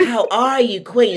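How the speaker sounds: high, gushing hello; excited welcome to an honored guest; delighted greeting. Real, not acted. Host greets arriving guest.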